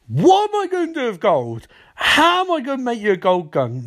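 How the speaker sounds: high-pitched exasperated protest; retold exasperation. Cisco objecting to making a gold gun, exasperated. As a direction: wail it in mock bafflement.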